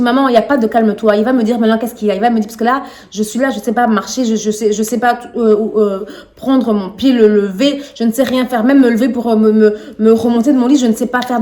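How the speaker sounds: loud, fast angry outburst; snapping at mother in a hospital bed; losing it. Retold or imitated. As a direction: shout down mother's calming. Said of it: "j'ai pété un câble".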